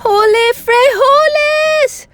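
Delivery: very high, slow, loud yell; battle cry. A narrator's reading. El Scapito thrusting his sword, high battle cry. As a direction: hold each word in a triumphant cry. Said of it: "cried Scapito".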